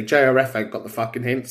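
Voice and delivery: normal talking voice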